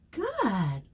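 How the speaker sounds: ordinary delivery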